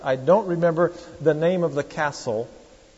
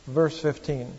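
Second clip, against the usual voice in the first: ordinary delivery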